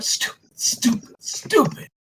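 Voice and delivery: hushed, breathy self-reproach; whispered self-scolding. Acted. Scared young prisoner whispering, scolding himself. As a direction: hiss it at yourself in panic. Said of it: "he's, like, going".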